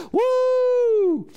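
high, loud celebratory woo; joyful whoop. Real, not acted. Preacher to congregation after the story, loud joyful whoop. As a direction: whoop it out with joy.

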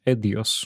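"Eidos" misread as ED-ee-us; misread pronunciation. Retold or imitated. Dyslexic gamer recalling his first reading, "Eidos" mispronounced ED-ee-us. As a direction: say "Eidos" with its middle letters swapped, ED-ee-us. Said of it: "I read that the first time as".

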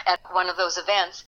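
normal talking voice

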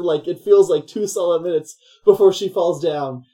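ordinary talk, nothing marked

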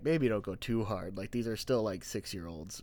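small, quiet inner voice; a little worried voice in the back of the head; inner caution voice. Retold or imitated. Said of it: "a small part of me that was like".

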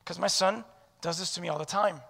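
normal talking voice

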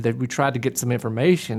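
ordinary talk, nothing marked

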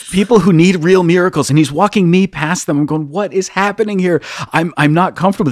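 high-pitched, flustered retelling; mortified exasperation. Retold or imitated. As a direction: relive the embarrassment, voice lifted well up. Retells his acute embarrassment past queue.

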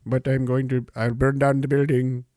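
low, muttering mock threat; a hint of a low unhinged mutter.

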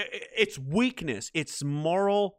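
normal talking voice

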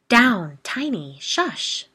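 loud sharp scolding at dog. Acted. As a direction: snap at the dog to calm down. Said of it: "we heard a woman scold".

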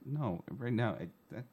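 hushed, panicked refusal; quiet, nerves taking over; stage fright. Real, not acted. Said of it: "I can't do that".

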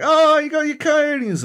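very high, loud cheer; high-pitched put-on encouragement; mock congratulation. Voices himself congratulating raging friend.

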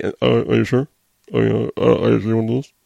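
halting, stammering imitation of a referee; mock confused referee. Referee asking the challenger if she's sure, mock confusion. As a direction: dither and restart like a baffled official. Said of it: "he was so confused, didn't know".

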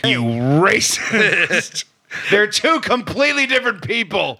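drawn-out mock-indignant accusation; mock outrage. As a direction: play at being scandalized, dragging it out. Mock-accusing co-host of racism.

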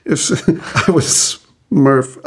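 words broken by laughter; giggling through it; laughing. Real, not acted. Laughing mid-sentence.

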